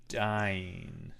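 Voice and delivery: low, drawn-out murmur; hushed, solemn reveal; mock-grave hush. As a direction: let it drop away gravely. Mock-grave reveal after acted prayer.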